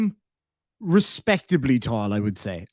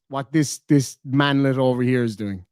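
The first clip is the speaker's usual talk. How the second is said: usual speaking voice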